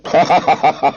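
staged ha-ha-ha of a heckler; mocking laugh. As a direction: laugh derisively at them. Student laughs at theologians.

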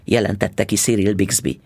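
usual speaking voice

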